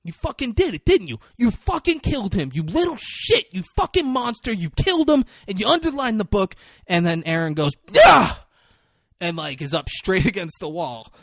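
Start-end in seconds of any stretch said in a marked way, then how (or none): 0.0-6.5 high-pitched acted tirade; retold yelling. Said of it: "he, like, keeps yelling". 7.9-8.3 sudden high, loud acted yell; panicked yell. Retold man freaking out against wall.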